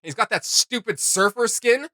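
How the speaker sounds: normal talking voice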